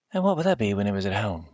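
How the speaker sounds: a lightly voiced wary old countrywoman; suspicious country woman. Wary country woman. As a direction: Missus Owens questions Silas about the banana. Missus Owens eyes the banana.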